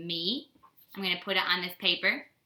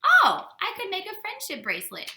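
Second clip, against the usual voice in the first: excited; 11 semitones higher than usual. Retold or imitated. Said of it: "and it says".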